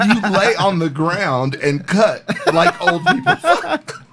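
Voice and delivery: high, slow quote broken by laughter; laughing through it. Real, not acted. Finally getting the coach's joke, laughing. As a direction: laugh out the punchline.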